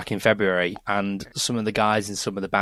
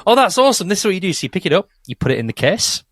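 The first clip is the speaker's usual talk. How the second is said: high, mock-enthusiastic dad; imagined encouraging dad; 8 semitones higher, faster than usual. Co-host picturing dad finding the bass, mock encouragement. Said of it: "I just pictured your dad going".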